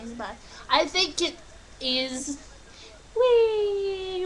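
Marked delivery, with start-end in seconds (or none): 3.2-4.3 high, drawn-out gleeful squeal; playful whee. Real, not acted. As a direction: let out a long, high whee. Playing with her toy dude.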